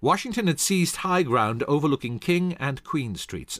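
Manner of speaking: usual speaking voice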